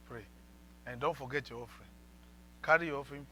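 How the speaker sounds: ordinary delivery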